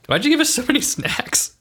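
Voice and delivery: ordinary talk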